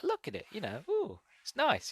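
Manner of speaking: high, soft cooing show-off; imitating a pleased little show-off; proud showing off. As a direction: coo proudly over a prized possession. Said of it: "he's really proud of it".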